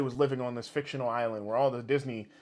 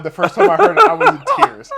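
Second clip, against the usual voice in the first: loud, high, cracking up; real laughing; 17 dB louder than usual. Real, not acted. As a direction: tell it through uncontrollable laughter. Recalling it while cracking up.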